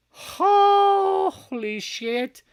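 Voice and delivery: very high, loud, long-held first word; astonished exclamation. Real, not acted. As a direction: stretch it out in loud amazement. Astonished reaction on the stream.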